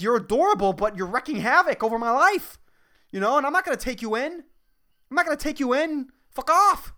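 high-pitched mock scolding, building to a yell; exasperated mock yelling, pitch climbing; retold screaming at dogs. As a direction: imitate ranting at stray dogs, rising to an outburst. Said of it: "It's me screaming at these dogs".

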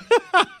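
real laugh that breaks out high; burst of laughter. Real, not acted. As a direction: crack up at your own story. Host cracks up at story.

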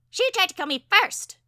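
high, loud squawking bird voice; piercingly high parakeet protest; exclaiming parakeet. A narrator's reading. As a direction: burst out indignantly as a tiny bird. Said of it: "the parakeet exclaimed".

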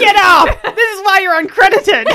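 high, loud jeering yell; mock heckling. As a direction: heckle loudly, on the edge of laughter. Joins in heckling the actor.

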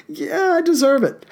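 high-pitched mock self-righteousness; joking. Host ribbing podcast friend Tony, high joking voice. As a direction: jump up high for a teasing, joking claim. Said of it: "Just joking".

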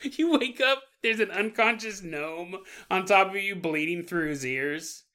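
ordinary talk

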